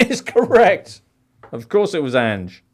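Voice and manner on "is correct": high, drawn-out triumphant confirmation; emphatic quizmaster call. Real, not acted. Quizmaster confirms Charlie's answer, emphatic high call. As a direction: pitch leaps up as you announce it, slowly.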